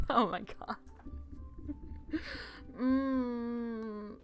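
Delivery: ordinary talk, nothing marked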